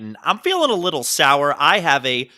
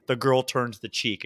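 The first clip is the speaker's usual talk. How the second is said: ordinary talk, nothing marked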